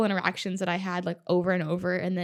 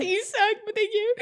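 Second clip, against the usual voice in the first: much higher squealed retort; laughing teary retort; 14 semitones higher than usual. Retold or imitated. Finisher answering Joey, high teary squeal. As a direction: snap back through tears and laughs.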